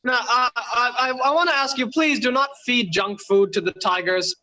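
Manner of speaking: normal talking voice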